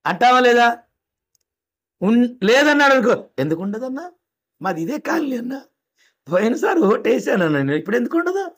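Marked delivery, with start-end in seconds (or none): 3.4-8.5 soft, hurt protest that rises at the end; upset voter. Acted. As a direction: protest quietly, hurt, then lift. Voter protests name missing. (పేరు లేదని voter బాధగా మెల్లగా నిలదీస్తూ)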